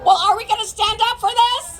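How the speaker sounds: fast, high rallying shout. Real, not acted. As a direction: fire up the crowd. Rally speaker rousing the crowd.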